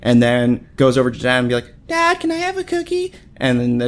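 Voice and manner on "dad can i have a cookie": put-on much higher, wheedling little-kid voice asking dad; pleading kid. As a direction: play a kid trying dad after mom said no. Kid begging dad for cookie.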